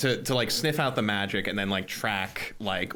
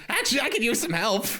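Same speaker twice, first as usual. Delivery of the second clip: high-pitched little creature asking for help; small, high creature voice; [name]'s creature voice; 13 semitones higher than usual. Acted. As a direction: pitch it up like a tiny helper. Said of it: "he turns and goes".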